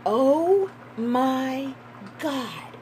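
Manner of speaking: high, loud oh my God in awe; real stunned excitement; shocked exclaim. Real, not acted. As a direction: gasp it out in shock. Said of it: "I felt, like, shock and awe".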